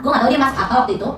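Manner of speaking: ordinary delivery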